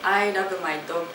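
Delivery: "dog" with a Japanese-accented added vowel. Shown as a demonstration. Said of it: "speak in this Japanese accent".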